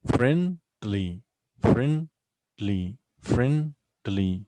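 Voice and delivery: "friendly" slowly split as friend, ly; syllable by syllable. Shown as a demonstration. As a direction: clear gap between "friend" and "ly" in "friendly". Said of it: "how many v-, syllables here".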